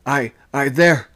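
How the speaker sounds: shaken, stumbling over the first word; stuttering. A narrator's reading. Shaken sailor trying to answer the mate, stuttering. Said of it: "I stuttered".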